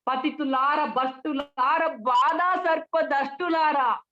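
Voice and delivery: high, slow, ringing declamation; rally the crowd, stretching each address; rousing oratory. Shown as a demonstration. Speaker quotes verse to striking workers at a rally, rousing oratory. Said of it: "ఎలా చెప్పొచ్చు".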